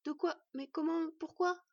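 high, rapid-fire startled questions; bewildered stammering. A narrator's reading. Boy questioning his dying mother, bewildered. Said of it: "balbutia-t-il".

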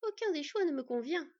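a touch of icy defiance; coolly. A narrator's reading. Fox girl refuses the thief's bargain, coolly. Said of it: "lâcha fraîchement Sylveg".

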